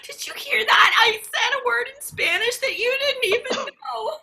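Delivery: loud, high and laughing through it; laughing shout. Acted. Student brags to her partner about a Spanish word, laughing loudly. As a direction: crow with delight, half laughing. Improv scene, proud laughing outburst.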